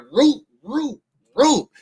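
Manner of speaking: high mock barking root root; imitating a barking dog; dog bark imitation. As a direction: yap it out like the angry dog. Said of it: "That thing was like".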